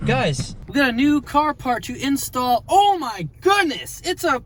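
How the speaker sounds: high, thrilled squeal; excited. Real, not acted. Unboxing excitement, voice jumps high.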